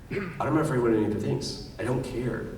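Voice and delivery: ordinary talk, nothing marked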